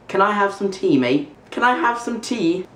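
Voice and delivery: high-pitched amateur British accent; attempted British accent. Shown as a demonstration. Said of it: "I don't think I've ever done an accent before".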